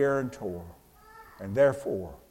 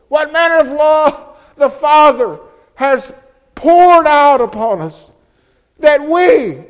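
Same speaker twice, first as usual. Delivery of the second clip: much higher and louder, ringing climax; full-force preaching; 11 semitones higher, 12 dB louder than usual. Acted. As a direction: lift the whole room with the love of the Father.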